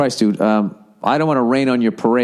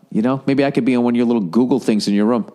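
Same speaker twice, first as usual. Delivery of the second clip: ordinary talk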